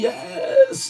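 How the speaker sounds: squealed high yes; excited yes. Real, not acted. Cheering with the chat as music plays. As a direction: squeal it in glee.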